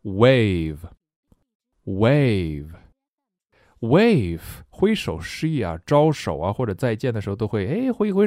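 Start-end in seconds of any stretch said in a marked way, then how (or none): 0.0-4.4 "wave" stretched very slowly, syllable by syllable; drawn out "Wave" repeated; slow model word. Shown as a demonstration. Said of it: "teacher models new word".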